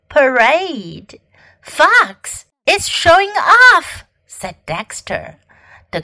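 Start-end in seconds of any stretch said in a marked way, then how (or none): 1.6-4.0 high, slow singsong complaint; tattling schoolmate. A narrator's reading. Dexter complaining to the band about Fox, tattling. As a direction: report the show-off, pitched up high.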